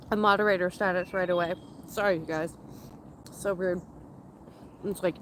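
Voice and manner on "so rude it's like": talking through a mouthful of food; words round a bite of food; mouth full. Real, not acted. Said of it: "speaking with my mouth full".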